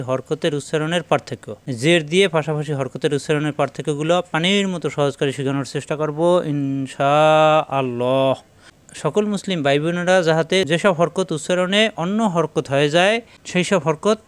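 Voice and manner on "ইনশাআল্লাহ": slow, intoned phrase with long held vowels; chant-like, unhurried devotional phrase; drawn-out inshallah. Real, not acted. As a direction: stretch it out like Quran recitation.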